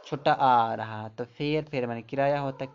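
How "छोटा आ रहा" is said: छोटा drawn out slowly, the आ held long; teacher to class: stretch out छोटा आ slowly; slow vowel demo. Shown as a demonstration. Teacher showing the vowel to students, slow and deliberate. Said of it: "उच्चारण ए भी आ रहा".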